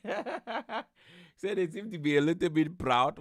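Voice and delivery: slowed by laughter, high and amused; laughing disbelief. Real, not acted. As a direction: echo it through incredulous laughter. Laughs repeating guest's remark.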